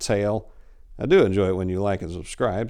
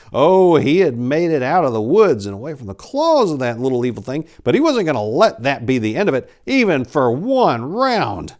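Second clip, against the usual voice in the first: building fury; 4 semitones higher, 6 dB louder than usual. A narrator's reading. Priest's vengeful rage narrated.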